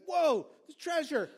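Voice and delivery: retelling the finder's surprise; amazed discovery. Retold or imitated. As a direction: gasp at the treasure. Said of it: "He's like".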